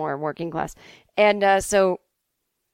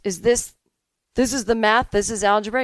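louder, halting disbelief, rising on "algebra"; incredulous questioning; 5 dB louder than usual. Acted. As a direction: question it as if you cannot believe it. Mark questions teacher again, acted.

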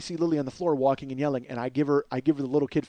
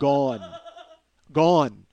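Gone punched out loud and final; "Gone" hit hard and loud, dropping at the end; emphatic; 6 dB louder than usual. Shown as a demonstration. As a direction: slam the word Gone down like a verdict. Playlist deleted, dramatic emphasis.

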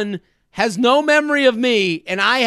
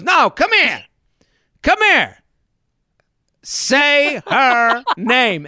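teasing insistence; 4 semitones higher, slower than usual. Retold or imitated. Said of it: "he goes".

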